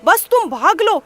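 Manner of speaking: no time to explain, just run; frantic rabbit. A narrator's reading. Rabbit hurrying the bear away, frantic. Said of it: "खरगोश तुरंत बोला".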